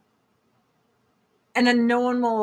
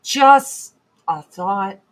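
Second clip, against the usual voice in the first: sarcastic; 2 semitones lower, 6 dB louder, much slower than usual. Real, not acted. Sarcastic aside after insult.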